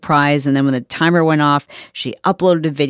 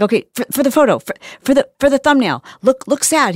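high, flustered mock stage-mom urging; coaching mother; 10 semitones higher, faster than usual. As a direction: imitate a mom pressing for the shot. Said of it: "she is coaching her son".

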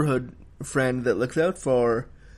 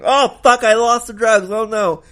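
much higher and much louder panicked cry; freaking out; 7 semitones higher, 8 dB louder than usual. Retold or imitated. Zoo panicking over the lost drugs, a loud high frantic cry. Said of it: "and freaks out".

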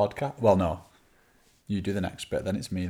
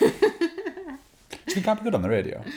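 radio voice; 3 dB louder, slower than usual. Acted. Mock radio voice question.

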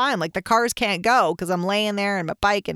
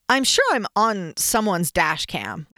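usual speaking voice

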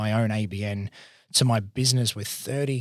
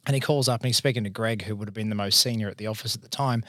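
ordinary talk, nothing marked

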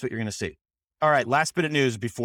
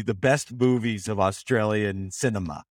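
usual speaking voice

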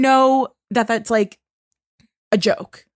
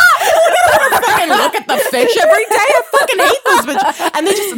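loud, high laughing rant; cracking up while ranting about fish; 12 semitones higher, 6 dB louder than usual. Real, not acted.

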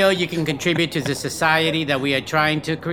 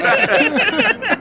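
much higher laughing squeal; squeaky echo. Acted. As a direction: squeak the word out mid-laugh. Echoing legit, squealing.

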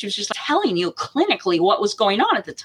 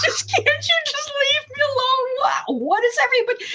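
high, pleading wail, retold; squealed mock desperation; mock distressed plea. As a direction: imitate an overwhelmed woman in labor, very high. Acting out mother's plea in labor.

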